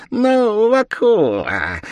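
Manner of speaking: high, loud demon squeak; piercing little devil voice; squealing devil. A narrator's reading. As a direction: squeal it out, drawing out the name. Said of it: "пропищал чёрт".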